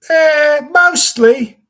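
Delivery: loud, high mock old British man; Gary Oldman. Imitating the character's answer, loud and high. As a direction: belt out a hammed-up old Brit's reply. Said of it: "And he said".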